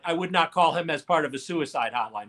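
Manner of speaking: ordinary talk, nothing marked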